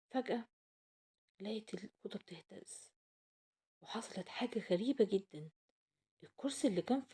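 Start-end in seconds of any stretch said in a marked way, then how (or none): 0.1-5.5 hushed storyteller whisper. A narrator's reading. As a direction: whisper it like a secret. Mysterious moment in story.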